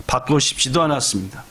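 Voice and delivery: ordinary delivery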